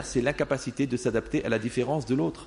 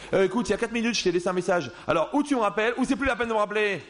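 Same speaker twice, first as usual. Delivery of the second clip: annoyed caller; 6 semitones higher, faster than usual. Retold or imitated. Angry caller's voicemail message.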